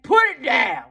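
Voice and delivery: shouted "Put it down" with the punch on "Put". Acted. First scolding take.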